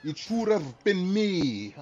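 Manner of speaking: slow, drawn-out mock Russian accent; hammed-up Russian version of a meme quote, very slow. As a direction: stretch it out in a fake Russian accent. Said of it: "but in Russian".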